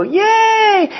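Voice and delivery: high, loud squeal of celebration; cheering. Acted. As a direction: whoop it up for a baby's small triumph. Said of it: "And you go".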